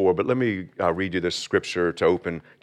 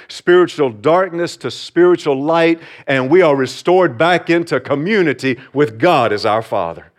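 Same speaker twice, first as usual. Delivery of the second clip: rising, louder pulpit preaching; 6 semitones higher, 6 dB louder than usual. Acted. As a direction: build the sermon with fervour. Preacher builds sermon to climax.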